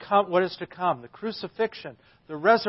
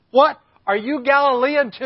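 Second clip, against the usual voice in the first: high, loud scornful question; indignant priests shouting back; outraged retort; 8 semitones higher, 11 dB louder than usual. Acted. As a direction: throw it back as an acted angry challenge.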